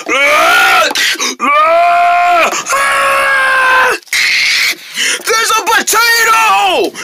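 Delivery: high, drawn-out shriek of alarm; panicked screaming. Acted. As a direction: scream in hammed-up terror at a creature coming near. Panicked at creature coming near.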